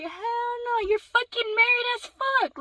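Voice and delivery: high-pitched, indignant outburst; retold angry retort. Daughter answering mom back, retold angry retort. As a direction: fire back in retold outrage.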